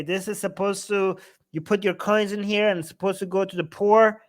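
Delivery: ordinary talk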